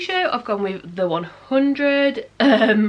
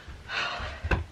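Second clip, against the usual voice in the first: sigh; 9 dB quieter than usual. Real, not acted. Pause before answering a question.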